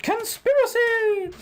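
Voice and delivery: high, slow, drawn-out cry; mock outburst. Player joking about the church, high drawn-out mock cry. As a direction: ham it up like a wild accusation.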